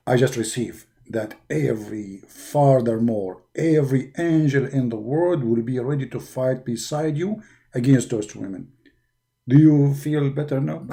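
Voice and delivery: very low, hushed angel voice; Jibreel's voice. Acted. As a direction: speak deep and hushed.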